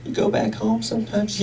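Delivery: lightly voiced imaginary questioner; a faint off-stage voice prodding [name]; imaginary interviewer voice, barely changed. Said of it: "imaginary voice asking me".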